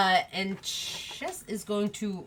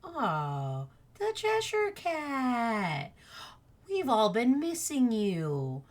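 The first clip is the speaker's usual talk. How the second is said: slow, drawn-out creature voice, softer; little tiny worm; 3 semitones higher, 4 dB quieter, much slower than usual. Acted. Worm greets the sad Cheshire Cat, slow tiny voice. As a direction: stretch the words in a small slow voice. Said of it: "and goes".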